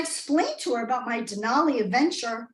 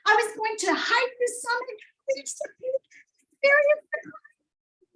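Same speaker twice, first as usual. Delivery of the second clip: dreamy; 10 semitones higher than usual. Pretend. Repeats goal, dreamy.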